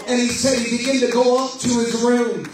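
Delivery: fervent revival preacher building his voice to the climax; preaching at full force. Acted. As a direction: preach it out, rising to a roar.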